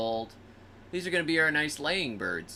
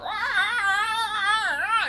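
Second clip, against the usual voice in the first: very high squawking hen cry; shrill imitated hen panic; 4 dB louder, much slower than usual. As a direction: screech like a dragged hen. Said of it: "The hen's going".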